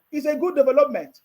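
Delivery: usual speaking voice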